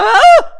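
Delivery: high, loud playful shriek; laughing fake scream of fright; mock scream. Mock fright at two ghosts.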